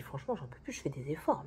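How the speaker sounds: hushed, worn-out whisper; giving up, barely voicing it; exhausted whisper. Real, not acted. Fed up with the coworker, whispered. Weary venting about coworker.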